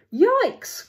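high, loud yelp; hammed-up cry of alarm. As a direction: yelp like a startled storybook monkey. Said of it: "cried the monkey".

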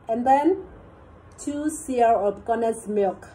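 slow, spaced-out dictation of each amount; teacher slowly dictating ingredients to the class. Shown as a demonstration. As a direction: read the list slowly so students can write it.

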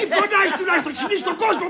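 flustered, high-pitched reproach; alarmed scolding. Acted. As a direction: beg a noisy drunk to stop. Pleads with drunk to quiet.

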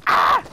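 loud, high yelp of panic; panicked yell. Real, not acted. Gamer under fire, high panicked yell. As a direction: yelp as the shots hit.

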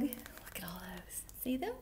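soft whisper. Real, not acted. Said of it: "whispered to child".

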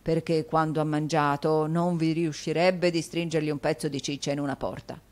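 ordinary delivery, nothing marked

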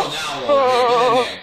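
loud, high, pushing the team forward; rally the squad mid-fight; excited urging shout. Real, not acted. Urging teammates in a firefight.

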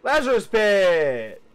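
loud, slow, thrilled call; excited greeting. Real, not acted. Gamer finding the pit at last, calling out excitedly.

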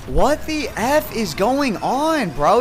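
slow, loud, high anguished yell; furious whine. Real, not acted. Gamer dreading another goal by the opponent, slow anguished yell. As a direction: stretch it out near tears of rage. Raging about opponent scoring.

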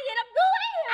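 high, loud startled echo; shocked question. Acted. Wife mishearing funeral as wedding, shocked. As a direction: shriek it back in disbelief.

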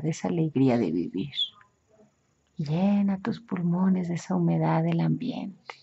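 calm, measured hypnotic suggestion; hypnosis guidance. Acted. Hypnotherapist guides imagined scene. (voz de hipnoterapeuta guiando)

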